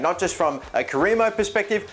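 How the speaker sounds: ordinary delivery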